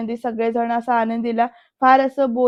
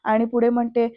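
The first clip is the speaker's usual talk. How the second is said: usual speaking voice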